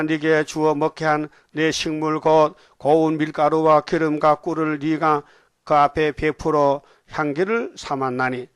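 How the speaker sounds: slow, solemn read of the verse; scripture reading. Acted. Preacher reading Ezekiel aloud to the congregation, solemnly.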